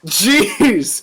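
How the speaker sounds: sudden high startled cry. Real, not acted. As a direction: jump at a loud noise. Startled by something dropped.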